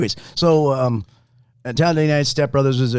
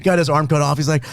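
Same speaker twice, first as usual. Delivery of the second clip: normal talking voice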